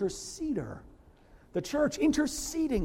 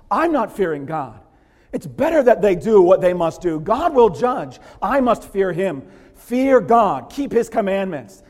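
firm declaration; 4 semitones higher, 11 dB louder than usual. Acted. Voicing what Abraham should say.

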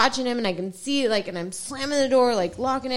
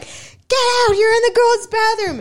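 high, loud yell at an intruder; acted-out outraged shriek; retold scream; 10 semitones higher, 6 dB louder than usual. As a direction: shout him out in a high voice. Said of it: "Screaming at him".

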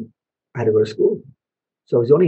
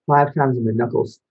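ordinary delivery

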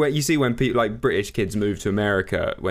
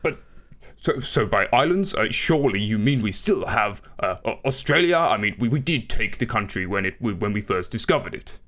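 stammering, confused Prime Minister; Boris Johnson. Acted. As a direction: stumble through it in baffled disbelief. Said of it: "do your fucking Boris Johnson accent".

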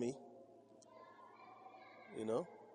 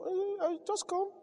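high-pitched coaxing eh eh, just come; high, eager welcome; inviting call; 4 dB quieter than usual. Retold or imitated. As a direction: coax them in, voice jumping high. Voicing inviting pastor.

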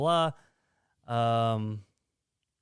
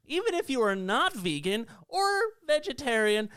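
higher, put-on upset mum; high mock mom voice; 8 semitones higher than usual. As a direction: play a fussy worried mum, pitched up. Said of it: "I don't like how you did a, that voice".

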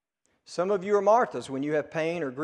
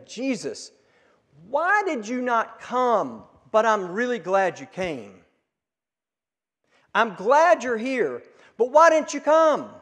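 pleading grief; 5 semitones higher, 6 dB louder than usual. Retold or imitated. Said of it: "it's as if she is saying".

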